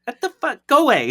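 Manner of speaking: high, laughing shoo-off; laughing mock snap. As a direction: brush the kid off while cracking up. Merlin shooing child, laughing.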